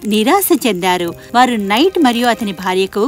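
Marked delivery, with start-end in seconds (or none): none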